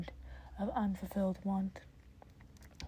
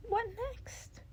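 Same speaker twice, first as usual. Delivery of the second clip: higher, whispered mentor suggestion; Nezu's voice; 13 semitones higher than usual. Acted. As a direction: suggest it lightly, pitched up. Nezu voiced by reader.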